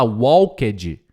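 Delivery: "walked" as "wal-ked-ji"; students' mispronunciation. Shown as a demonstration. Teacher repeating students' error, "walked" as "wal-ked-ji". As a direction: add L and extra syllables to "walked". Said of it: "Eles vão falar".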